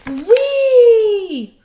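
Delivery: long high loud whee; tortoise flying through the air; joyful whee. Acted. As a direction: fling it out with glee.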